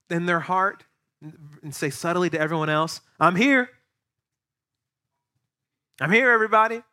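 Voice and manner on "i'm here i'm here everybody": higher, louder "I'm here" showing off; proud announcement. Retold or imitated. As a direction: demand the room notice you. Voicing proud person entering.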